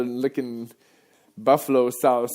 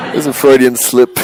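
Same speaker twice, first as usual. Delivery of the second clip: loud laughing excuse; 13 dB louder than usual. Real, not acted. Laughing off the slip on stage.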